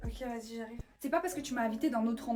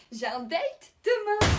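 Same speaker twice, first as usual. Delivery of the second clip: excité; 9 semitones higher, 8 dB louder, much slower than usual. Acted. Annonce excitée d'un rendez-vous.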